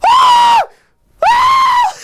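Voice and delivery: piercing high screams; screaming. Retold or imitated. Retelling his shower discovery, loud high screams. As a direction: scream like Marv in Home Alone. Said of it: "I was screaming".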